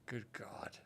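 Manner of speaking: whispered dread. Real, not acted. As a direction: breathe it out, dreading.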